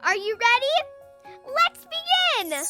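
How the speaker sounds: ordinary delivery, nothing marked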